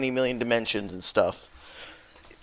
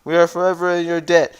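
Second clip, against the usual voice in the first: higher, fast gushing thanks; grateful father; 4 semitones higher than usual. Retold or imitated. Father thanking the thief again, high gushing thanks. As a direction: gush out the gratitude. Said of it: "he's like".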